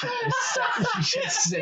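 high, slow laughing mock of her; sputtering with laughter; laughing mimicry. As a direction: giggle through the words. Mocking the medium, laughing.

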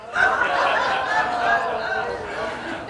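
high breathless laugh at own joke; real laughter at the punchline. Real, not acted.

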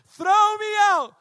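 high, very loud, stretched yell; shouting over wind. Acted. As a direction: holler it slowly through the storm. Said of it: "You have to shout".

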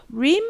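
"Rim?" with an ee-like vowel, rising sharply; unsure pronunciation. Shown as a demonstration. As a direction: offer "Rim?" as a guess with a questioning lift. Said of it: "don't know how you pronounce".

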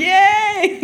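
high, bright-eyed mock cheer of a winner; pretending to celebrate a competition win; mock winner's cheer. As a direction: squeal it like a pretend champion. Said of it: "I couldn't have been like".

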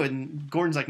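usual speaking voice

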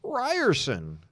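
high, drawn-out name as a buzzer; buzzer call. Pretend. Player buzzing in before guessing Bill Murray, high-pitched call. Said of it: "come up with a buzzer sound".

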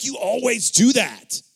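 loud, high, drawn-out mock complaint; heated accusation. Husband blaming Faith for a repeated habit, loud mock outburst. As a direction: accuse them in exaggerated exasperation.

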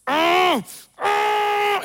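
sharp rising yelps of pain; pained cry. Retold or imitated. Retelling the trick, a mock pained cry. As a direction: cry out like the blisters hurt. Mimics man's painful cry.

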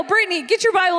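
ordinary delivery

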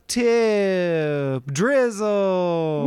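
slow, stretched-out hype name; drawn-out hype. Acted. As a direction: drag the name out like a hype man. Hyping donor name.

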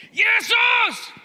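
very high, loud yell of the name; full shout. Acted. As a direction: scream the name across the crowd. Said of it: "han höjer rösten ännu mer".

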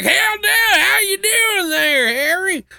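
a silly high trucker, drawn out; mock trucker greeting. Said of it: "they're like".

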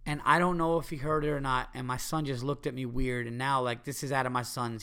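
usual speaking voice

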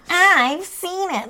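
very slow, high-pitched mock disdain; dismissive Daphne. Daphne turning down the tour, exaggerated disdain. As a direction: drag it right out, high and airy. Daphne's reply, acted out again.

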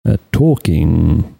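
low, slow movie-trailer boom from the diaphragm. Shown as a demonstration. Podcaster redoing his trailer voice for his cohost, low slow booming delivery. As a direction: drag it out deep like an epic trailer narrator. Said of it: "I don't know if I can do it again".

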